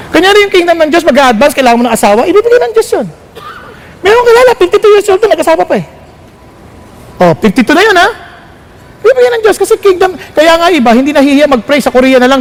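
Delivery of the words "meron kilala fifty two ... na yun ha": ordinary delivery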